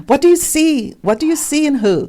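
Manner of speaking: acted-out nosy disbelief, high and pushy; mock incredulous questioning. Acting incredulous questioners of the couple.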